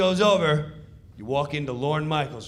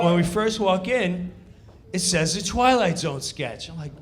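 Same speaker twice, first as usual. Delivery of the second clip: ordinary talk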